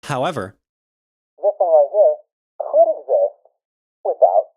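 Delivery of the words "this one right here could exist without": a narrow ringing slice of the voice; isolated frequency band. Shown as a demonstration. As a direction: voice reduced to a thin high band. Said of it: "like, the frequency band right here".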